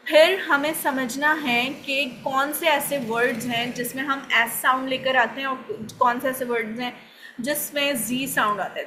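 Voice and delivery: normal talking voice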